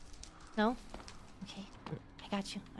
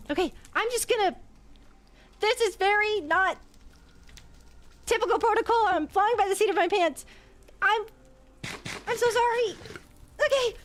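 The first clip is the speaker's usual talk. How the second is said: flustered panic; 8 semitones higher, 3 dB louder than usual. Acted. Flustered healer mid-surgery.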